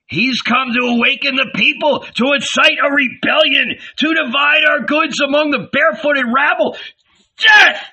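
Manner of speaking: high, rabid accusation; frenzied high-pitched mob outrage; howling. A narrator's reading. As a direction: howl it, ending in a loud yell. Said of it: "they howled".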